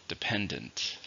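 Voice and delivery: "Dependent" softly, stressed on PEN; stress on second syllable. Shown as a demonstration. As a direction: model "Dependent", leaning on the middle syllable. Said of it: "stresses on the second".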